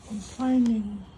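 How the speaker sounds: ordinary delivery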